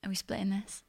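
a quiet imitation of a date splitting the bill; mumbled quote. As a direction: mutter it sheepishly. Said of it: "getting to the drive-through bit at the end and going".

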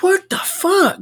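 high-pitched, incredulous reaction; baffled disbelief. Retold or imitated. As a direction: let the pitch leap in bewilderment. Bewildered reaction to the coworker.

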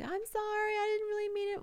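high-pitched mimicked pleading; squeaky mock sorry voice; mock apology. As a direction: imitate the rival making a feeble excuse. Mimicking rival's apology in retold quarrel.

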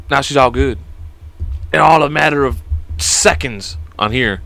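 ordinary delivery

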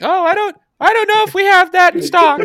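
put-on high, squeaky child voice; little kid voice. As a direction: pretend to be a young boy on the phone. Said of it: "I have a little kid voice".

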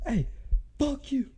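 high yelled Hey, fuck you; imitated yell. David provoking the big kid, a high mock yell. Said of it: "he goes".

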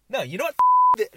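loud, high pretend blow-up; mock outburst. Joking to parents who may be listening, mock angry outburst. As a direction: fake losing it, jumping up into a yell.

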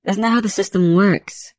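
high-pitched, firm rebuttal; refuting. A narrator's reading. Judge refusing investigator's warrant plea, refuting. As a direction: shut the argument down. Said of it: "she refuted".

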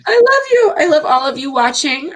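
high-pitched, crying declaration of love; emotional, tearful. Real, not acted. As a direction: choke up with real emotion, pitch climbing. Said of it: "I'm sorry, I'm so emotional".

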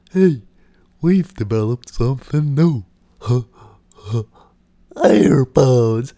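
loud, hammed-up product launch voice; mock ad pitch. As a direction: hype the new gadget like a cheesy ad.

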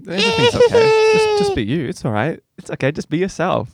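high, hammed-up comforting voice, almost crying; mock soothing. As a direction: coo at him like a fretting parent. Mock soothing a nervous guest.

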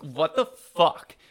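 loud, drawn-out outraged outburst; indignant NPC outburst. Acted. Game master acts outraged character.

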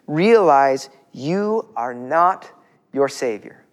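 slow dictated point. Shown as a demonstration. Pastor dictating point two, very slow. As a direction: Realize you are not your Savior, drawn out slowly. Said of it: "Write this down".

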